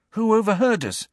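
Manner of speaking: urgent high-pitched question; demanding. A narrator's reading. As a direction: demand to know, pitch jumping up. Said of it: "Harry demanded".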